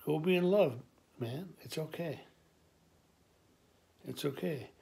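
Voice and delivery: dad soothing his nervous son; comforting dad. Retold or imitated. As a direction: soothe him quietly.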